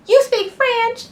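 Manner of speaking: high, loud, drawn-out mock amazement; exaggerated naive character voice, slow and high; Mimi's voice. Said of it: "that's how she talks".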